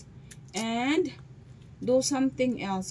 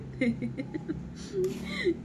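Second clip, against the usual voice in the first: soft laugh; 7 semitones higher, 6 dB quieter than usual. Real, not acted. Laughing at bitter coffee.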